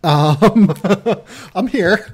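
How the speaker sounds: laughing. Real, not acted. Cohost stalling on a Brunson question, laughing. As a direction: try to answer while cracking up.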